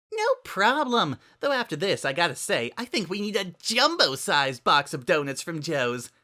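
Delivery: high, loud cheerful chatter; bouncy party girl voice; bubbly Pinkie. Acted.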